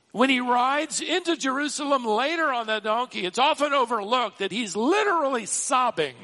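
high-pitched, urgent pulpit delivery; impassioned preaching. Real, not acted. Preacher telling how Jesus weeps despite knowing all, impassioned. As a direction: build the case insistently, high and forceful.

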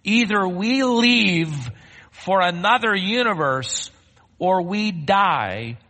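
slow, high, drawn-out proclamation; dramatic ultimatum. Acted. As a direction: stretch out each choice for the congregation. Dramatic reading of the article's ultimatum.

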